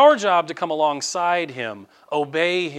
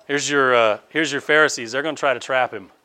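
ordinary talk, nothing marked

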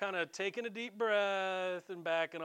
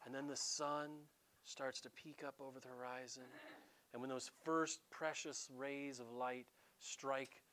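hushed storybook narration; 3 semitones lower, 10 dB quieter than usual. Acted. Preacher mocking an idyllic childhood storybook, hushed narration. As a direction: paint the perfect sunrise in a hushed voice.

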